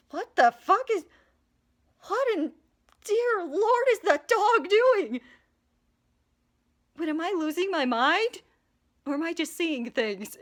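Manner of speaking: high, loud alarmed churchgoer; mock panic. Frightened churchgoer staring at the dog, high pitched alarm. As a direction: freak out at what you see. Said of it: "scared of".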